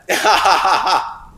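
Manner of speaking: high, loud laughter; real burst of laughter; emotional laugh. Real, not acted. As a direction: laugh it off, overwhelmed. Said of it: "That was me getting emotional".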